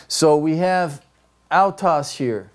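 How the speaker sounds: usual speaking voice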